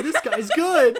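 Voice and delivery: high, loud, laughing through a goon's panic; laughing mock panic. Co-host laughing as fleeing goons. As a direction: squeal it out while cracking up.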